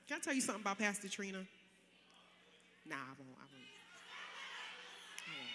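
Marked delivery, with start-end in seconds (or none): 2.9-5.5 much lower and softer, holding back a secret; teasing low refusal. Real, not acted. Preacher to her congregation, a teasing low refusal. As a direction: tease them by refusing to tell.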